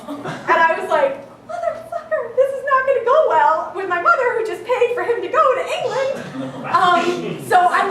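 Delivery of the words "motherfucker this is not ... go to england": laughing dismay. Retold or imitated. Realizing mother paid for trip.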